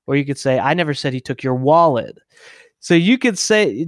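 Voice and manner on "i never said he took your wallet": "wallet" punched at the end, falling; stress on WALLET. Shown as a demonstration. Showing how emphasis changes meaning, stress on "wallet". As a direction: save the emphasis for the last word, "wallet". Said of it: "putting emphasis on a different word".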